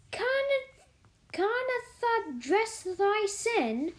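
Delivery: high, slow, astonished broad Yorkshire; broad Yorkshire, amazed. A narrator's reading. Martha amazed that Mary cannot dress, broad Yorkshire. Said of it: "she spoke in broad Yorkshire in her amazement".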